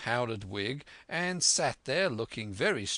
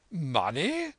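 higher, rising exclamation; incredulous cry; 5 semitones higher, 4 dB louder than usual. A narrator's reading. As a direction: squire astonished at the question. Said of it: "cried the squire".

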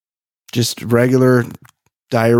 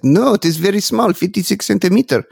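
put-on higher-pitched French accent; 4 semitones higher than usual. French hat seller reassuring a worried customer, French accent. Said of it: "that's my French accent".

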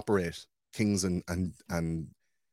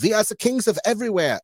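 high, loud pompous king voice; hammed-up, loud royal proclamation; mock royal boast; 13 semitones higher, 6 dB louder than usual. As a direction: ham up a snooty inbred monarch. Mocking inbred European royals.

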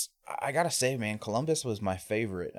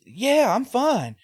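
high, loud, giddy fight-club guy; pumped up; 8 dB louder, much slower than usual. Retold or imitated. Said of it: "He's all pumped up".

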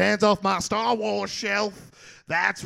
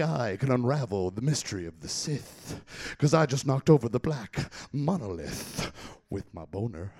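much lower, softer, ominous; low mysterious voice; 10 semitones lower, 8 dB quieter than usual. Acted. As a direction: whisper it like a dark prophecy.

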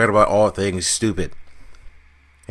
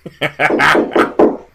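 laugh; 7 semitones higher, 4 dB louder than usual. Real, not acted. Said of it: "That sounds like a laugh".

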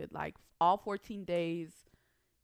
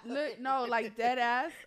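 laughing insistence; 4 semitones higher, 7 dB louder, slower than usual. Real, not acted.

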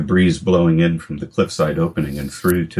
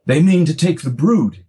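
high alarmed call; boy calling out in alarm; 12 semitones higher, 4 dB louder than usual. Acted. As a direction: warn father across the nest. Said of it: "he called".

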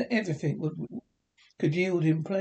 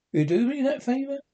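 fast, much higher pleading question; eager plea; 9 semitones higher, 3 dB louder, faster than usual. A narrator's reading. Scrooge urges the gentleman, fast and higher pitched.

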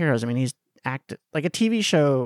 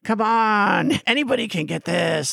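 impatient teasing; 8 semitones higher than usual. Retold or imitated. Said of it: "people would be like".

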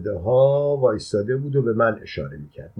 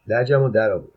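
plain reading voice

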